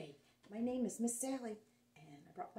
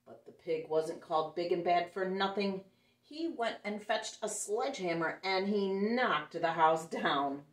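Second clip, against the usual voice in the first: lively, louder read-aloud storytelling; story narration; 8 dB louder than usual. A narrator's reading. Storyteller reading a picture book to children, lively and louder. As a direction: read it out big for the kids.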